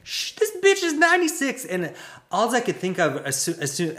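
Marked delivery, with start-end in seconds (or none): 0.0-1.7 much higher, louder mocking jab; scornful retort. Mocking news stories about the Queen's health, scornful. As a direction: sneer it out as a sarcastic put-down. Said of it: "It's like".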